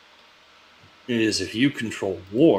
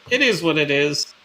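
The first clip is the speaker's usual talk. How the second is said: higher, louder impression of another man; impersonation; 7 semitones higher, 7 dB louder than usual. Crewmate imitating a shrugging friend's likely answer, impersonation. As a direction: put on his voice, pitched up and louder. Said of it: "That's a pretty good impersonation".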